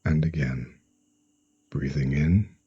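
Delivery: slow meditation voice with long pauses; guided sleep hypnosis. Acted. Hypnotherapist guiding a listener to relax for sleep, slow and soothing. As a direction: ease the listener into stillness, phrase by phrase.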